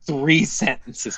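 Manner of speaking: normal talking voice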